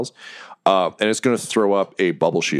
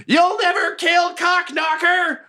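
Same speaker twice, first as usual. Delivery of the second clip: very high, slow goblin shout; goblin scream; much slower than usual. Acted. Goblin taunting the party from behind a crate, very high shout. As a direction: shriek your own name in defiance. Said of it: "you hear him scream".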